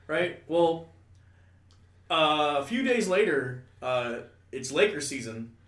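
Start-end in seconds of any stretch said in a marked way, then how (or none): none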